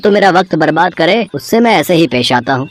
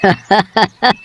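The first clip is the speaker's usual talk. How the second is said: short scornful laugh at a fool; a quick derisive chuckle; mocking laugh; 5 semitones higher than usual. Mocking the lottery belief.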